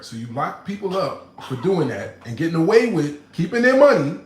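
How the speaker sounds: loud, high, fired-up rant; heated rant. Real, not acted. Ranting to chat about Martha Stewart, loud and fired up.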